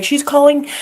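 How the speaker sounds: hammed-up impressed viewers; mock fan voice. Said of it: "and people are like".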